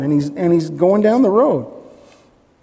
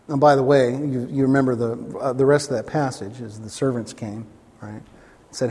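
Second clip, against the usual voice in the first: ordinary talk, nothing marked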